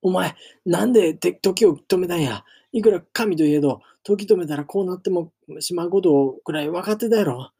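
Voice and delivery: high, loud anguished scolding in Kansai speech; tearful scolding. Acted. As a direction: scold him through welling tears. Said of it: "両目に涙をためていった".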